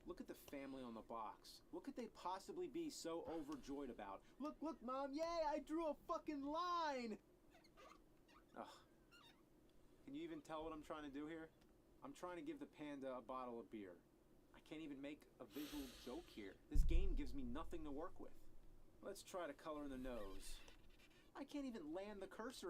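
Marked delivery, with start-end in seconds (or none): 4.4-7.2 much higher and louder, a slow, hammed-up childish squeal; mocking excited kid. 16.7-18.4 much louder, fed-up complaint; exasperated outburst. Real, not acted. Frustrated at the drawing.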